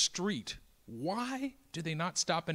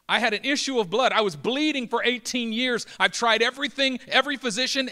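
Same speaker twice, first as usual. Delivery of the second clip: higher, louder, eager rush; excited testimony; 6 semitones higher, 7 dB louder than usual. Acted. Healed woman telling Jesus her story, excited and loud. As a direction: pour it out like an overjoyed woman.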